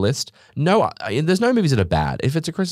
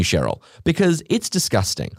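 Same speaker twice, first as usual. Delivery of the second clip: ordinary delivery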